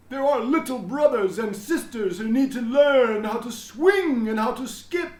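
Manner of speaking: a beaming principal proclaiming to everyone; proud headmaster announcing. A narrator's reading. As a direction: announce it grandly to the whole school. Headmaster addressing the school.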